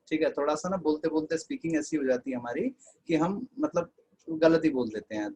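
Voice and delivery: ordinary talk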